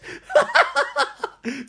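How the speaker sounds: high-pitched cackling laugh; shrieking fit of laughter; real laughter. Real, not acted. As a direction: crack up helplessly. Cracking up at villain's fall.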